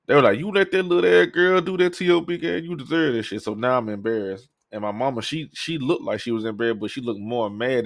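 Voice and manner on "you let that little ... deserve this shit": put-on higher, teasing ridicule of the big kid; mock him like the laughing adults; mocking taunt.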